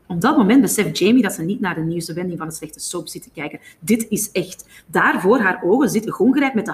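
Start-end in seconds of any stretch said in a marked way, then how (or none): none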